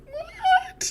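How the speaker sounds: loud, cracking falsetto yelp; mock-innocent high squeal; voice breaks. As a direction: squeak it out very high, half laughing. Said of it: "Voice breaks".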